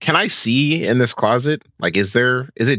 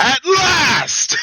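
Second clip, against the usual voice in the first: triumphant shout. Acted. Korag rushes in with a high triumphant shout. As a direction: bellow it like the moment finally came. Korag hears Strahd's name.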